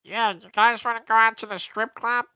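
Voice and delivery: high, loud, pinched nasal alien voice; nasal character voice through a held nose. Shown as a demonstration. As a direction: pinch your nose and pitch it way up. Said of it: "let me hold my nose while doing it".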